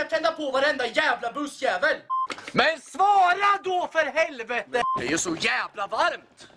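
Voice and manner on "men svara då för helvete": slow, loud, high yell; angry drunk yelling; shout. Acted. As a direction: demand an answer, drawn out. Yelling at unresponsive terminal.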